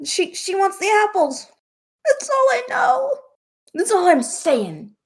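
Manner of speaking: high, squeaky, panicked blurting; panicked captive. Acted. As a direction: blurt it out in terror. DM voices scared captive.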